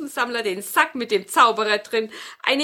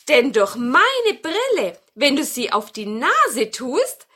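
good witch, enthusing; 7 semitones higher, 6 dB louder than usual. Acted. Witch explains her magic glasses to grandmother, high wondering voice. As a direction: marvel in a raised witch voice.

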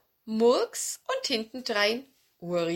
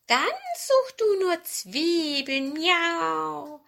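high, drawn-out cat voice; high-pitched cat meow; 9 semitones higher, 4 dB louder, much slower than usual. A narrator's reading. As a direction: mew the words slowly. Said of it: "mauzte die ururalte Katze Murks".

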